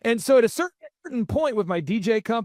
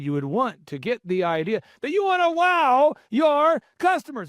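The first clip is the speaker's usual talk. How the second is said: Michael Levine; 4 semitones higher than usual. Retold or imitated. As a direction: stretch it out like a grand pronouncement. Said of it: "And he's so theatrical about it".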